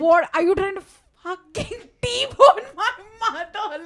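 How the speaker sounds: high, loud, drawn-out mock outrage. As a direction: act out your shocked anger with a laugh. Angry retold outburst at funeral guest.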